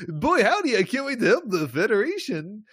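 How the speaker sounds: high, loud, hammed-up eager cheer; mock peppy. Mocking a cheerful crewman eager to help the Federation, peppy. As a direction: gush like a too-eager recruit. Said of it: "everyone being all peppy".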